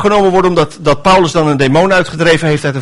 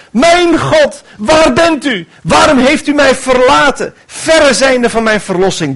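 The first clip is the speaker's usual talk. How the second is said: desperate cry; 9 semitones higher than usual. Acted. David alone in his fear crying out to God, desperate. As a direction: call out to an absent God in fear. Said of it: "riep hij naar God".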